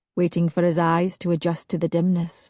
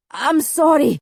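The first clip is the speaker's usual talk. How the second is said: anguished apology; 8 semitones higher, 5 dB louder than usual. A narrator's reading. Alistair pleading in dream.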